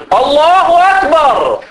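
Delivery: high, slow, drawn-out call; showy loud prayer. Retold or imitated. Son calling out his prayer so the family hears, slow and high. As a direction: announce your prayer to the whole house.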